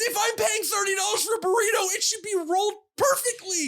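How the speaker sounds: hammed-up outrage at overpriced burritos; ranting.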